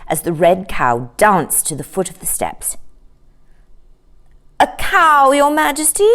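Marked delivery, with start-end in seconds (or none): 4.6-6.1 high, simple cow's voice; simply. A narrator's reading. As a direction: state it plainly, in a high voice. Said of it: "she answered simply".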